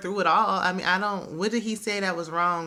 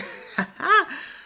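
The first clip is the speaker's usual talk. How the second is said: high, real burst of laughter; 11 semitones higher than usual. Real, not acted. Host bursting out laughing at the reality show moment. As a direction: crack up at the memory. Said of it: "this is where I hollered".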